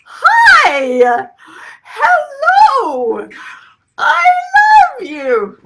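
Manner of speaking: put-on high, loud cooing baby talk; slow drawn-out baby coo on I love you. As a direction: gush at them like a newborn. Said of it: "talking to you all like you're a six-week-old baby".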